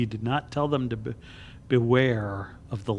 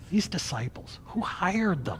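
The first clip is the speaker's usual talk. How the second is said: whispered aside; 7 semitones higher than usual. Real, not acted. Mocking aside about disciples.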